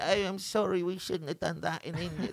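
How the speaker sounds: hammed-up contrite English whimpering; mock apologetic English. Mocking English people apologising over India, put-on apologetic English voice. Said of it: "in England".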